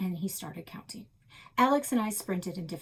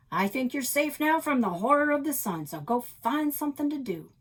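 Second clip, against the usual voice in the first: higher, louder playful grandpa; mock dramatic grandpa; 6 semitones higher, 7 dB louder than usual. Grandpa playfully shooing the kids off to play. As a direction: tease them about the sun's horror.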